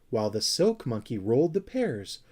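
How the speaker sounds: plain reading voice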